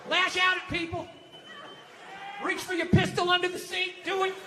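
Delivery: high, goading mock voice; angry inner voice. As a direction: egg someone on to stay angry. Voices heckler's little angry circle.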